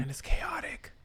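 breathy aside whisper. Real, not acted. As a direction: whisper it under your breath.

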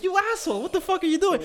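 high-pitched mock outrage; comically appalled telling-off; retold indignant scolding. Retells scolding the influencer.

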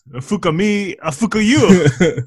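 mock Japanese accent. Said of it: "that was pretty racist".

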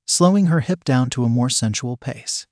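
usual speaking voice

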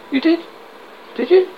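high, curious double-take; interested. Acted. Policeman hearing a confession, high and intrigued. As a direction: perk up at a confession. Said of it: "Bobby, interested".

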